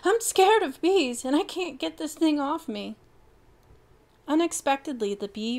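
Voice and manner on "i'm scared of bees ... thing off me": high, frightened whimper; panting and scared. Acted. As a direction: Bronco whimpering to Alexis about the bee. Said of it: "Bronco whimpered, panting wildly".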